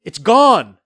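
loud high cry; cried out. Acted. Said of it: "I cried".

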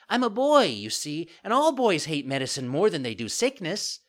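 put-on high, loud child voice; puppet boy, cheeky. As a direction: explain brightly like a cocky child. Puppet figure in story.

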